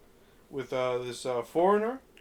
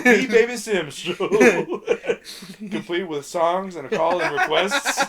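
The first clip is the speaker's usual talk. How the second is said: laughing; 3 semitones higher, 8 dB louder than usual. Real, not acted. Laughing while retelling.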